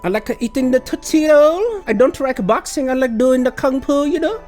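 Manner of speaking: put-on high-pitched caricature of a Chinese accent; mock Chinese accent. Mocking a claimed change of identity, fake Chinese accent. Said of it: "I'd use a Chinese accent".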